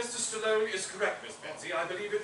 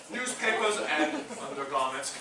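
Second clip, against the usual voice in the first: ordinary talk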